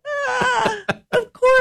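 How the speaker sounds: high, exaggerated ah of course; sarcastic sing-song agreement; mock realization.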